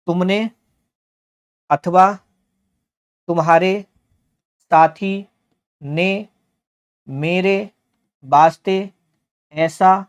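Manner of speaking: one word at a time, long pauses between; teacher's halting, deliberate read-aloud. Shown as a demonstration. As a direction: read out each word separately, lifting its end.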